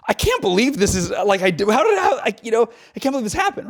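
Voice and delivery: high-pitched, quick, incredulous outburst; amazed disbelief. Retold or imitated. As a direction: imitate someone stunned by their own success. Voicing amazed disbelief of listener.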